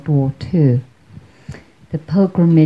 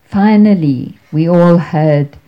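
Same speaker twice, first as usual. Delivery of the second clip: ordinary talk, nothing marked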